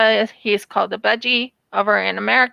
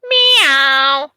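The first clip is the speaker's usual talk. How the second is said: a louder imitated meow, like the budgie; bird's meow; 5 dB louder than usual. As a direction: mimic the budgie's cat meow with more push. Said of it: "Same exact sound as Max Midnight".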